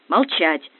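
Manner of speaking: ordinary delivery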